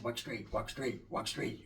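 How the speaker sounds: soft repeated walk straight, almost to himself; murmured repeat. Retold or imitated. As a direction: mutter it under your breath like a warning. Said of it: "He said".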